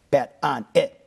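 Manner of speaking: BET ON IT punched word by word, loud; emphatic. Retold or imitated. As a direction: hit each word of "Bet on it" hard, separately. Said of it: "he was very emphatic".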